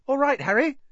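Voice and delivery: put-on high, bright-eyed young fan; eager boy voice. A narrator's reading. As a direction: chirp it up like an excited kid. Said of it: "Nothing seemed to give Colin a bigger thrill than to say".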